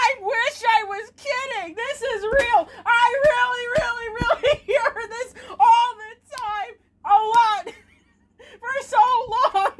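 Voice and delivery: high, near-tearful laughing wail; laughing lament. Real, not acted. Streamer confessing an obsession with the clip, laughing wail. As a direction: lament it while cracking up, pitched high.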